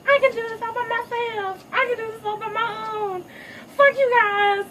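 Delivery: put-on high, loud defiant girl voice; defiant teen. Daughter telling Timon and Pumbaa off, high and defiant. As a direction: shout your independence in a high voice. Rebellious daughter declaring independence.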